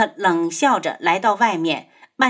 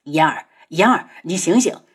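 urgent, anxious calling, barely raised; pained calling out; 4 semitones lower than usual. A narrator's reading. 轩辕志 rouses fainted 嫣儿, anxious calling. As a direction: call her urgently with an aching heart. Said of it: "他心疼的大叫".

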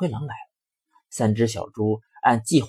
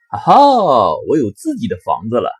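gleeful pig; 9 dB louder than usual. Acted. Eldest pig cheering over his new straw house, gleeful. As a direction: burst out happy at owning a house. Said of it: "老大乐得欢蹦乱跳".

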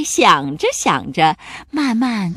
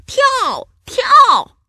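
very high yelled command, louder the second time; shouting; 7 dB louder than usual. A narrator's reading. Pirates forcing the monster off the plank, shouting. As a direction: order the jump with full cries. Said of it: "海盗们大叫道".

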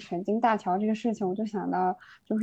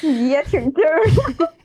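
laughing teasing; 6 semitones higher, 6 dB louder than usual. Real, not acted. Co-host reacts, laughing.

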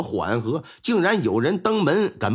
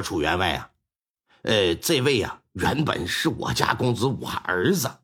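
lightly voiced old man; 11 semitones lower than usual. Elderly father explains.

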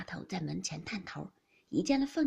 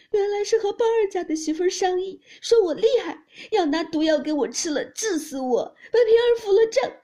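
loud, high-pitched wailing account; crying complaint; 6 semitones higher, 9 dB louder than usual. A narrator's reading. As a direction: pour out your grievance in a loud, tearful wail. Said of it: "凤姐哭道". (高声哭诉)

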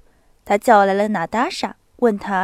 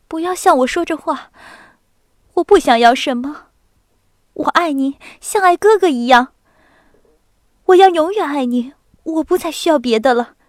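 恳切的姑娘; 4 semitones higher, 5 dB louder than usual. A narrator's reading. 索尼娅恳切地回答.

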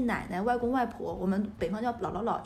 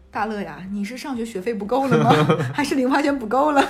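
louder, mock-serious ribbing of her son; teasing mom; 8 dB louder than usual. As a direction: tease him with fake suspicion, laughing. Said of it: "很搞笑地问他".

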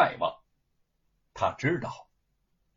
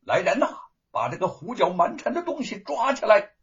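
calling out for help; 7 semitones higher than usual. Acted. Calling guards from inside.

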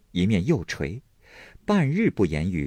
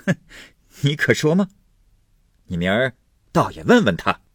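scornful; 5 semitones higher, 3 dB louder than usual. Acted. Husband's angry retort in quarrel.

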